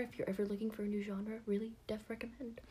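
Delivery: soft unvoiced aside; breathy whispered recommendation. Real, not acted. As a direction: whisper it like a secret tip. Aside to listeners.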